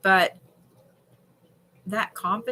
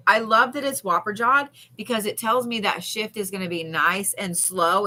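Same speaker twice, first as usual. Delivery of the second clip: ordinary talk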